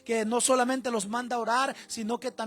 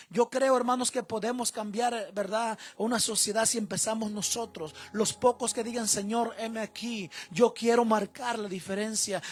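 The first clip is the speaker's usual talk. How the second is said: loud, rousing sermon delivery; fiery preaching; 6 dB louder than usual. Acted. Pastor urging the men to be strong fathers, fiery preaching. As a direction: preach it at full force to the congregation.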